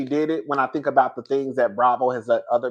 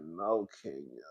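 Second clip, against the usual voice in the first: soft, low, drawn-out lament; mock mourning; 11 semitones lower, 17 dB quieter, slower than usual. Quoting herself watching Kenya, a soft lament. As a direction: grieve over her in a hush. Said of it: "I said".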